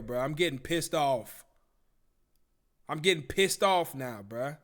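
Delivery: ordinary talk, nothing marked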